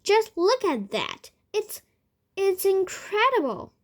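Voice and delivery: put-on excited boy pointing out a wonder; amazed shouting. A narrator's reading. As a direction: marvel out loud. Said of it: "he was shouting".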